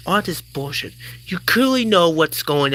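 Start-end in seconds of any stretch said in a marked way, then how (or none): none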